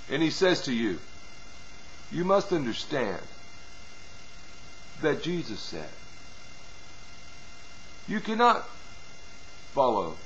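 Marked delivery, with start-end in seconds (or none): 2.1-10.1 soft, coaxing devil's voice; talking real soft. Retold or imitated. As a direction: speak softly, like honey on the lips. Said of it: "And he's talking real soft".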